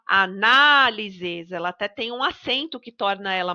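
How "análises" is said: análises with a long, drawn-out NA; stress on NA. Shown as a demonstration. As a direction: slowly stretch the NA in análises. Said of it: "É o na".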